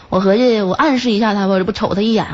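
ordinary talk, nothing marked

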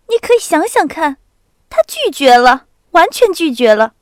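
高声兴奋地连连强调; 像憋不住的大新闻一样说. A narrator's reading. 娜达莎激动地讲. (兴奋的少女嗓音)

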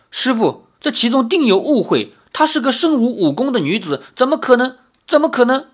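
high-pitched, agitated defence; distressed disciple insisting on innocence; urgent pleading. A narrator's reading. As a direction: plead with rising disbelief to the master. Disciple pleads with furious master for her.

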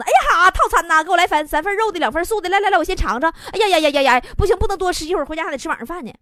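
hammed-up greedy excitement; very excited. Woman imagining the customer ordering diet meals, mock excited gabble. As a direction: gush the order out eagerly. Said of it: "那你是不是非常兴奋地说".

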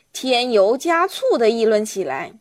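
usual speaking voice

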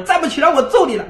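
a lightly acted nagging mother's threat; mother threatening.

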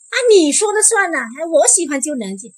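high, loud, mock-incredulous retort; teasing disbelief. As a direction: scoff as if she can't decide it.

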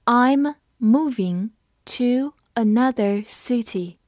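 I'm moving to another city said slowly, word by word; slow reading. Shown as a demonstration. Teacher slowly reading the example sentence. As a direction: read it slowly for learners. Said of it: "我们慢读一遍".

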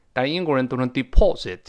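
ordinary talk

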